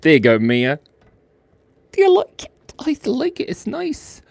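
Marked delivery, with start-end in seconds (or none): 1.9-4.2 much higher, squeaky little pig; squeaky cartoon pig. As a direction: voice the pig cutely. High voice for the pig.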